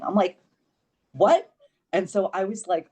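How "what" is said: indignant what. Retold or imitated. Retold reaction to rude staff.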